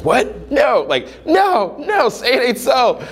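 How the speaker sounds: high, squeaky no no, say it ain't so; mock disbelief. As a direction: ham up a shattered denial. Imitating a shocked student.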